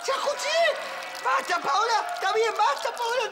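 high-pitched, fed-up cutting off; exasperated protest. Acted. Fed up with hearing the story, exasperated protest. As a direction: beg them to drop it, pitched high.

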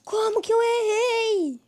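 high, loud, slow crying whine; frustrated whine. Real, not acted. Player frustrated missing boss.

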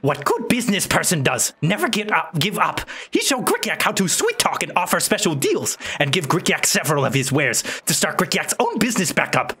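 high broken-English goblin; squeaky little goblin merchant. Acted. Goblin voice continues.

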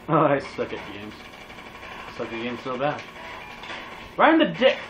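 usual speaking voice

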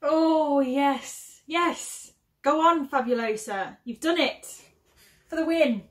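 high, delighted cheer; excited. Real, not acted. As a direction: cheer it on, thrilled. Delighted at a good scent.

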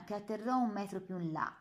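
ordinary delivery, nothing marked

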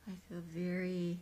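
playful slow sleepy-kitty voice; sleepy lulling. Pretend. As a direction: draw each word out like a mock hypnotist. Lulling cat, hypnotist-like sleepy talk.

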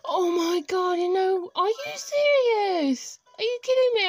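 real thrilled shriek; excited screaming. Real, not acted. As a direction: scream with joy and disbelief. Finds dream villager, screams.

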